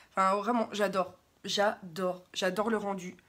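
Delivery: normal talking voice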